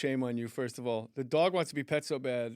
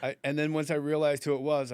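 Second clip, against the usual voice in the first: ordinary talk, nothing marked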